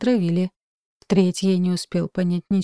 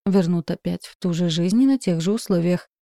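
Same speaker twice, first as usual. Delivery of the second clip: ordinary delivery, nothing marked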